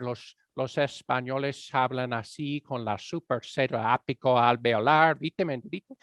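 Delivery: Castilian Spanish with a heavy lisp; mock-demonstration of a Spaniard's accent; lispy Spaniard accent. Shown as a demonstration. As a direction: exaggerate the lispy sounds of a Spain accent. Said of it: "a Spaniard is very lispy".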